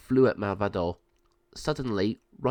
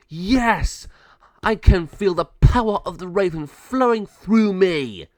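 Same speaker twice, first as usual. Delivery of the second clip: high, loud villain exultation; triumphant gloat; 8 semitones higher, 8 dB louder, slower than usual. Acted. Villain transformed into a raven, loud triumph. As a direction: revel in the power.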